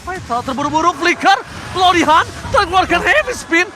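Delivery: rising hype call; esports caster. Real, not acted. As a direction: build up the play-by-play. Excited casting rising.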